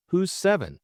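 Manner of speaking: ordinary delivery, nothing marked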